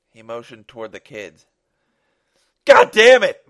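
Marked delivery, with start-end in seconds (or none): 2.6-3.5 high, furious desperate shout; crying out. Acted. Father crying out at the gunman, anguished high shout. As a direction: explode at full volume. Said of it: "Mark cried out".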